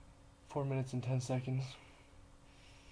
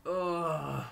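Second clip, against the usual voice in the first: strained groan; 4 semitones higher, 6 dB louder than usual. Real, not acted. Groan of effort.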